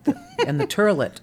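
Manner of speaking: mock old Brooklyn "turlet"; old New York pron. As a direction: say "turlet" instead of toilet. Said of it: "used to say Earl instead of oil".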